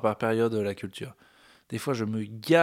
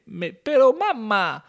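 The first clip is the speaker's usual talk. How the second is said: put-on whiny high Spanish-accent child pleading; 14 semitones higher, 6 dB louder, much slower than usual. Mexican kid complaining to his mother in a Spanish accent. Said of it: "j'essaie de parler espagnol, mais j'ai un accent".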